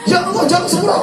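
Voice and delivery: hammed-up scolding yell; mock angry shout. Shown as a demonstration. Preacher jokingly translating the shouted istighfar, high and loud. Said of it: "Tahu artinya?".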